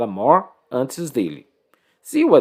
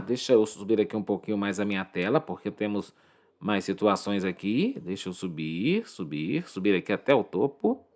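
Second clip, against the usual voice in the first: low, much softer aside to oneself; muttered aside; 5 semitones lower, 8 dB quieter, faster than usual. Real, not acted. Teacher scrolling the screen up for students, muttered aside. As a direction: talk under your breath while busy with something.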